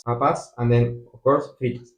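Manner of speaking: ordinary delivery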